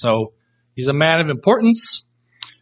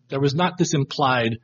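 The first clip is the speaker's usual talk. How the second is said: ordinary talk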